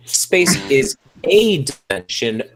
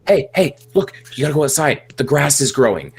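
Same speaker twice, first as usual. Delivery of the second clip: mock-urgent excitement, higher pitched at first; excited; 4 semitones higher than usual. Shown as a demonstration. Parent waking a child over something mundane, excited. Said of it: "just say it excitedly".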